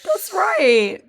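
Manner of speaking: high, loud echo of that's right; high mock echo. Echoing the friend's bit, high loud mock agreement.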